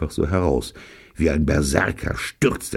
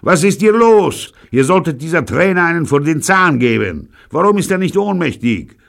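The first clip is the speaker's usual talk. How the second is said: loud, high furious shouting; raging, pitched-up demand; enraged boss; 10 dB louder than usual. A narrator's reading. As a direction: bellow at your henchmen. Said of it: "Wut verfärbt sein Gesicht".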